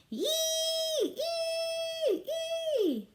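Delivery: very high piercing squeals; toddler screech. A narrator's reading. Tootsie joining the chaos, high screeching. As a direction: screech like a little kid. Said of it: "Tootsie kept it up".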